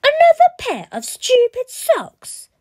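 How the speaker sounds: high, loud howl of "Socks!"; a spoiled boy howling in disgust; howling brat. Acted. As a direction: tantrum shriek of a greedy child. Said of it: "howled Bradley".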